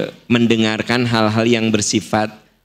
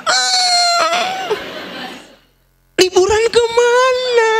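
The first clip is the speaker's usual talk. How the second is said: sing-song praise, far higher; kindergarten teacher; much slower than usual. Retold or imitated. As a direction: gush over a small child like a TK teacher. Meniru guru TK mengajar S3. (guru TK memuji murid dengan nada tinggi bernyanyi)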